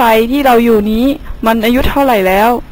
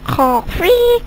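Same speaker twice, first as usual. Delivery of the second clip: frog croak; 7 semitones higher than usual. Acted.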